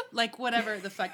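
ordinary talk

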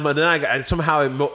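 normal talking voice

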